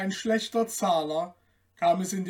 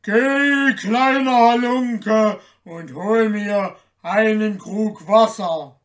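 commanding giant; 3 semitones higher, 9 dB louder than usual. A narrator's reading. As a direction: bellow the order with stretched words. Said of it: "sprach der Riese zu ihm".

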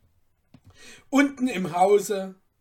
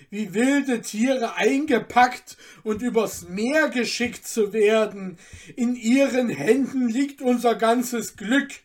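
louder begging voice; 4 semitones higher, 7 dB louder than usual. Acted. Cutler begging the merchant, imploring. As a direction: beg him once more. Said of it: "bat der Messerschmied".

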